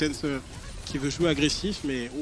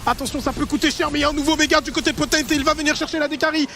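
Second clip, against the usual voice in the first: esports caster; 7 semitones higher than usual. Real, not acted. Caster calls final push.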